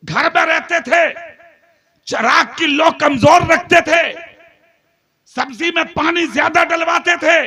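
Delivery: loud, impassioned sermon delivery; fiery preaching. Acted. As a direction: preach it to the whole gathering. Preacher at full force.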